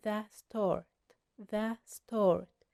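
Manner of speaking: "the" said short as "thuh" before "start", slowly. Shown as a demonstration. Teacher showing "the" before a consonant, slow and careful. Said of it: "فهتقول".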